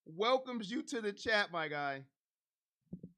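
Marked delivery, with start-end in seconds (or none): 0.0-2.0 hype intro. Acted. Streamer's signature greeting intro.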